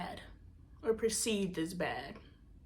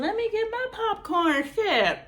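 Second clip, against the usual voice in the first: eager for gossip; 12 semitones higher, 6 dB louder than usual. Retold or imitated. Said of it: "And I'm like".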